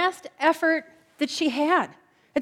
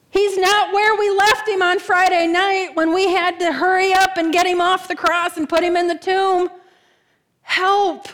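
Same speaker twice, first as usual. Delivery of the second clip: higher, louder panicked cry to Peter; frantic plea; 6 semitones higher, 5 dB louder than usual. Acted. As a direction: blurt it out in desperate alarm. Mary runs frantic to the disciples.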